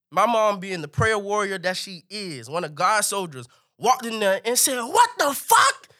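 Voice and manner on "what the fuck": very high, drawn-out cry of disbelief; loud mock shriek, stretched out; shocked outburst. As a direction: imitate a horrified mother walking in. Mother walks in shocked.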